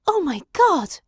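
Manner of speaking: high, loud gasp of horror; horrified gasp. A narrator's reading. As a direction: blurt it out in shock. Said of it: "I gasped".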